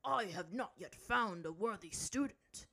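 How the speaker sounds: slow, measured refusal; stern master. A narrator's reading. Zhang Zhang rejecting would-be students, stern. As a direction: deliver it slowly as a stern master.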